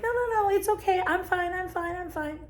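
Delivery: high-pitched, rushed insistence; hurried denial. Acted. People fighting their feelings, acting out hurried denial. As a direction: brush it off in a quick, high voice, mimicking denial. Acts out brushing feelings away.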